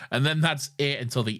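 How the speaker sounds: normal talking voice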